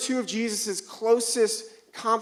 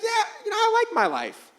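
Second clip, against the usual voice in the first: high, easygoing mock contentment; a light voicing of a content wife; imitating wife; 13 semitones higher than usual. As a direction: sound perfectly happy with things.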